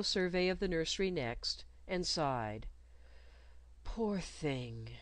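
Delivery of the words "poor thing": soft, low murmur of pity. A narrator's reading. Girl pitying the caged bird, softly murmured. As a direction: murmur it under your breath. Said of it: "she murmured".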